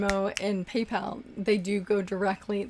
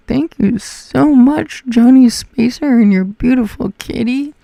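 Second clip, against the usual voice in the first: slow, loud, high amazement; amazed gushing; 5 semitones higher, 12 dB louder, slower than usual. Real, not acted. Streamer thanking Johnny Spacer, loud slow amazement. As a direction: gush over the donation. Big super chat reaction.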